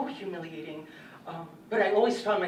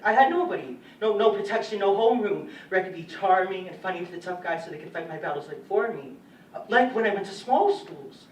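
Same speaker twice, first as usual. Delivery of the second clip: loud, raised-pitch distress; agitated outpouring; 3 semitones higher, 9 dB louder than usual. Acted. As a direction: pour out the grievance forcefully. Recalls having no protection at school.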